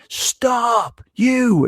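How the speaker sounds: high-pitched mock yell; strained, high "stop, you!" without full volume; mock chasing shout. Imitates cop chasing the nurse.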